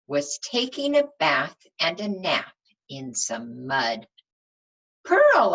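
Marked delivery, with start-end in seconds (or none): 5.0-5.5 high, loud call to the pig; bright call across the mud. Acted. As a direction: sing out her name. Calling out to the pig.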